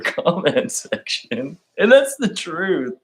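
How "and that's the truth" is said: mock pundit voice. Soundbite punchline.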